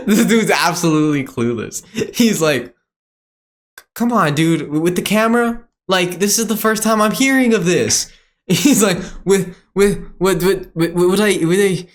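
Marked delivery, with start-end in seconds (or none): 3.9-11.9 stammering, higher-pitched imitation; mock confusion. Seller put on camera, imitated stammering confusion. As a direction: fumble for words as if clueless. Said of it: "he's genuinely confused".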